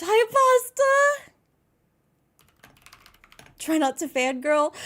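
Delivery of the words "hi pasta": high, loud, drawn-out hello; excited greeting. Real, not acted. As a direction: call out slowly in a high, excited voice. Greets someone arriving mid-emotion.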